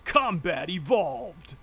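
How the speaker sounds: slow, booming announcer imitation; game announcer. Player imitating the announcer's callout from the game, slow announcer voice. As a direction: proclaim it like a game announcer, drawn out. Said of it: "the announcer actually says".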